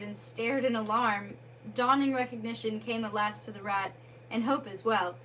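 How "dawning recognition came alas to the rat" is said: plain reading voice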